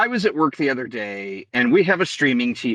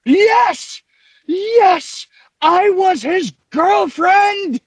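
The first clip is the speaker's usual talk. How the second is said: high, loud, slow dramatic cry; hammed-up shrieking confession; Frau Blucher quote; 7 dB louder, much slower than usual. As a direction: belt it out like a jilted lover.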